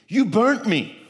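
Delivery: high, loud accusing cry; imitated shriek of pain; retold scream. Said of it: "she screamed".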